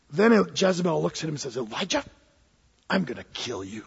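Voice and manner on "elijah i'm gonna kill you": low, quiet threat; menacing villain. Acted. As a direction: drop deep and soft to menace. Jezebel threatens Elijah.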